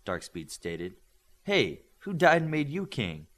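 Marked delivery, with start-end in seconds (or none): 1.4-3.3 snappy, indignant comeback; sassy retort. Acted. Midas snapping back at Darkspeed, indignant. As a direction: shoot back a challenge. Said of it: "Midas shot back".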